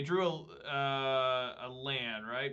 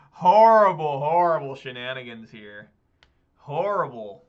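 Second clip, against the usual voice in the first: louder, appalled groan of a word; loud dismay; 12 dB louder than usual. Real, not acted. Player reacting to opponent's shenanigans, loud dismay. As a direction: bellow it, half laughing in despair. Opponent's play wrecks his plan.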